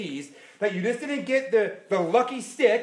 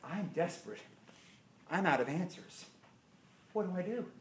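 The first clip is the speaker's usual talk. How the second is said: much softer, lower, hushed confession; desperate plea; 3 semitones lower, 10 dB quieter than usual. Acted. Moses quietly pleading with the Lord. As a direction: let him sound out of answers. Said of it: "Moses is saying".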